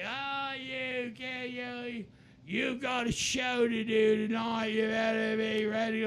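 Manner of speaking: sing-shout it like a rowdy rocker. Retold or imitated. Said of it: "he was just like".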